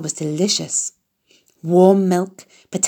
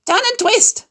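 very high, sing-song goose call; honking goose cry; 3 dB louder than usual. A narrator's reading. Goose urging Wilbur to dodge, high honking cry. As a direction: squawk the orders like a flustered goose. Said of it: "honked the goose".

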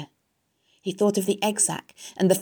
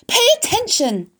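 very high, loud command; scream; 7 dB louder than usual. A narrator's reading. Wilbur demands the sleepy rat's attention, urgent scream. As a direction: yell it urgently. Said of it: "screamed Wilbur".

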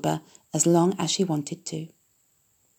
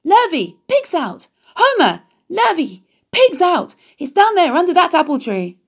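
much higher, loud alarm call; shouting; 10 semitones higher, 5 dB louder than usual. A narrator's reading. Mrs. Zuckerman shouting for the men about the pig, loud and high. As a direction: raise the alarm across the farm. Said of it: "she immediately shouted for the men".

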